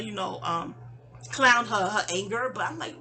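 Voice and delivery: normal talking voice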